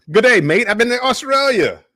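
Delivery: loud, high put-on Aussie greeting; mock Australian accent. Guest joking about visiting Australia, mock Australian accent.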